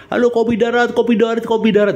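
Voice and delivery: high, brisk calling over the intercom. Retold or imitated. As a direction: play someone hailing on an old intercom. Acting out an intercom call. (memanggil lewat interkom, nada tinggi)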